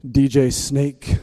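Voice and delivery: slow, deadpan grand citation; measured mock reverence with long pauses; mock-solemn. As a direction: quote a party lyric like weighty theology. Mock-solemn quote of rap lyric.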